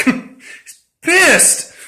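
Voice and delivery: high, giggling through words; laughing. Real, not acted. Laughing while describing friend.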